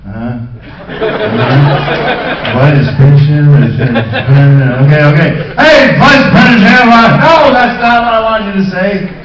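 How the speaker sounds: loud, laughing, confused mumbling; lost actor fumbling the lines; flustered actor. Retold or imitated. Actor floundering at new dialogue, laughing loud. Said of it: "me going".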